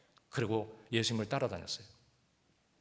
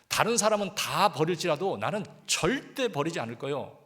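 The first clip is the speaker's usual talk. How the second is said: loud, confident promise as Peter; boastful vow; 4 semitones higher, 5 dB louder than usual. Retold or imitated. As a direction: boast it proudly to the Lord. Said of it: "큰 소리 쳤는데".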